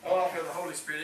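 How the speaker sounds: ordinary delivery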